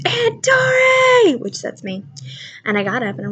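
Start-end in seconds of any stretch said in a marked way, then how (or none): 0.1-1.4 high, drawn-out yelling call; retold scream. Nephew screaming from his room for his aunt. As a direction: call out for your aunt in alarm. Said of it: "all of a sudden he screamed".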